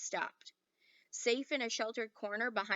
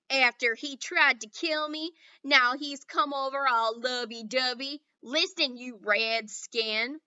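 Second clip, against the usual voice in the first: sneering cowboy; 3 semitones higher, 10 dB louder, slower than usual. A narrator's reading. Boone scorning Little Bear, loud cowboy voice. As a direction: spit it across the gap.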